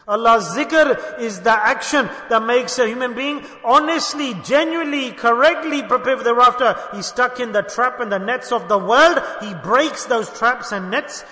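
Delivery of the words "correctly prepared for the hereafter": ordinary talk, nothing marked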